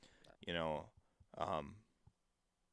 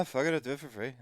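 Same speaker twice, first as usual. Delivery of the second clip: light, loose Irish accent with words barely spat out; lazy Irish brogue with blended, mumbled words; 3 semitones higher, much faster than usual. Shown as a demonstration. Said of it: "The, the Irish accents are lighter".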